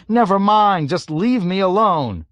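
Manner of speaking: high, loud despairing wail; wail, begging to be left alone. A narrator's reading. Broken pilot wails at navigator. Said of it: "wailed Yossarian".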